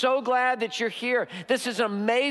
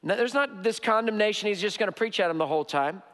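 usual speaking voice

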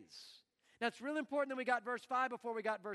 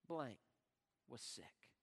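a sudden drop to a near-whisper; hushed diagnosis; 10 semitones lower, 10 dB quieter, slower than usual. Acted. Preacher reads the doctor's sentence, dropping to a hush. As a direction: let the verdict land softly and heavily.

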